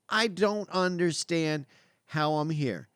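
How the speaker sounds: slow, spaced-out self-quote; slow emphasis. Retold or imitated. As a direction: weigh each word, pausing before HOW. Repeats it slowly for emphasis.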